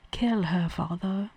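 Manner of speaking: soft repeat in a dubious British accent; attempted British accent. Shown as a demonstration. Showing partner the prince line, in a wobbly British accent. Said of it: "I was doing a British accent".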